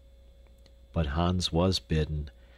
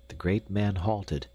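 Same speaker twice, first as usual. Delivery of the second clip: ordinary delivery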